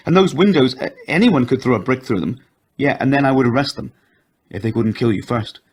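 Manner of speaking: loud, rising in alarm; anxious wife. A narrator's reading. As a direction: fear pushing her voice up. Wife frightened for their home.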